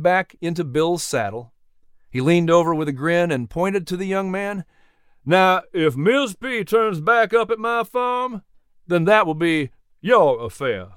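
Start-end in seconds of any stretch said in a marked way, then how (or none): 5.2-10.9 higher-pitched Southern gentleman, playfully refusing; kindly horse owner, amused. Acted. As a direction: play a genial Southern farmer. Jack's character voice in story.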